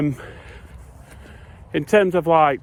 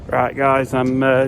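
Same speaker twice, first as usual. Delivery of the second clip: ordinary delivery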